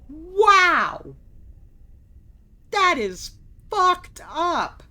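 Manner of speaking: loud shocked outburst, drawn out. Real, not acted. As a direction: gasp out loud in disbelief. Shocked at game lore.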